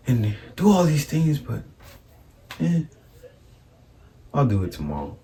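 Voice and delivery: soft, breathy stoner daydreaming; lazy stoner voice. Retold or imitated. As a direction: whisper it like a dreamy stoner. Impersonating stoned friend.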